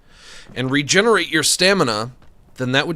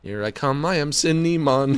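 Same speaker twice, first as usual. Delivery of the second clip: ordinary talk, nothing marked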